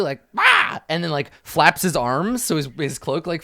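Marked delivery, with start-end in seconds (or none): 0.4-0.8 high, loud bird caw. Acted. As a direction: caw defiantly like a kid playing bird. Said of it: "a cawing sound at you".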